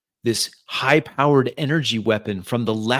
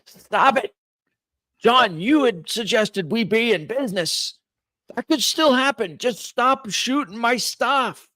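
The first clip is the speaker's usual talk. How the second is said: high, loud voice over mech speakers; mechanical voice; 12 semitones higher, 4 dB louder than usual. Acted. Mech pilot telling John to stop shooting, mechanical voice. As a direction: bark demands as the voice of a mech. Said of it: "mechanical voice says".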